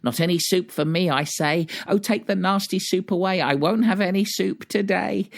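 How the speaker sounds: fast, high-pitched tantrum; hammed-up high childish protest; crying out. A narrator's reading. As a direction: rattle off the refusal like a stubborn child. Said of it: "cries out still".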